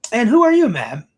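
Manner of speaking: high, loud opening, dropping on ma'am; inquiring event planner. Acted. Event planner asking Emily who she is, high formal inquiry. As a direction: formally demand a stranger's name.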